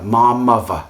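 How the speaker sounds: cheeky London Cockney; Cockney accent. Retold or imitated. Teacher imitating a Cockney speaker: Cockney accent. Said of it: "the Cockney accent is another classic example".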